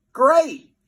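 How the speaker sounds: high, loud cheer of relief; boy yelling over the wind. A narrator's reading. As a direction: shout it out. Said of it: "yelled Fluffy".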